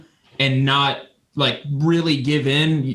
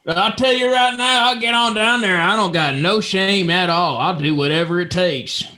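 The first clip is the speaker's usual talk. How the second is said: eager Texas hillbilly, starting high and settling; volunteer in a hillbilly twang; Hillbilly Bucky; 3 semitones higher than usual. Acted.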